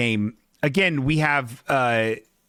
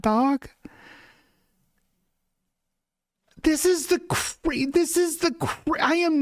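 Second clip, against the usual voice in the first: incredulous squeal; 13 semitones higher than usual. Real, not acted. Commentator squealing at the awful camera shot in disbelief. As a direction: squeak out total disbelief at the framing.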